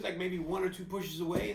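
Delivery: normal talking voice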